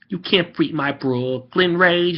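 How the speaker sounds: slow, high Brooklyn accent; Joey. Shown as a demonstration. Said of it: "Joey, Joey's".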